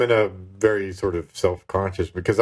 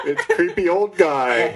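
laughing; 9 semitones higher, slower than usual. Real, not acted.

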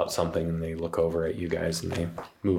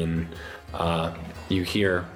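usual speaking voice

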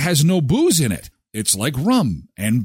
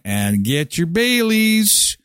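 slow teasing plug; 4 semitones higher, slower than usual. Acted. Ad plug for Baileys.

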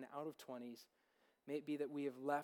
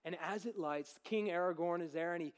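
ordinary talk

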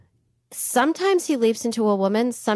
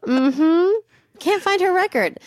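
higher, sing-song mock scolding; 6 semitones higher than usual. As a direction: tease the careless clerks in a high voice. Mocking record keepers.

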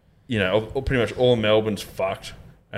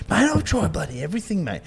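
high, put-on weary bloke; lightly voiced exasperated workmate; imitating workmate; 7 semitones higher, 4 dB quieter than usual. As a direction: act out a fed-up mate who has tried it all.

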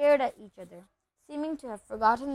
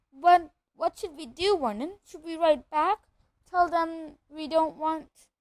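anxious pleading; 6 semitones higher, 4 dB louder than usual. Acted.

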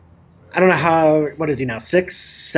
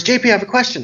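higher, lightly voiced impatient kid; retold impatient child; 8 semitones higher, much faster than usual. Boy interrupts talking adults a second time, mildly impatient child voice. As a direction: imitate a child tired of waiting. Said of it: "kids get impatient".

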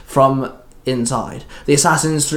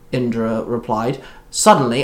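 ordinary delivery, nothing marked

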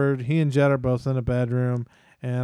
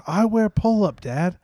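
lightly voiced little boy, lifted pitch; hint of a small child's pleading; toddler son; 5 semitones higher than usual. Toddler tells dad what he wants after bath, lightly imitated child voice.

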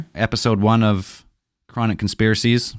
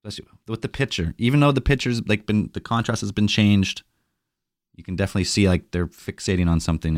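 ordinary delivery

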